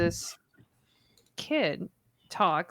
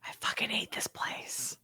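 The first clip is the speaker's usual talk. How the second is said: soft, breath-heavy whisper; hushed dread; 3 semitones higher, 10 dB quieter than usual. Acted. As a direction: whisper it as if spooked. In character, spooked by vanished child.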